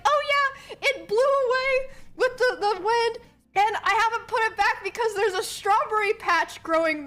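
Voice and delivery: high-pitched imitation, easing down as it goes; brother's breezy excuse. Brother explaining the missing fence, breezy excuse.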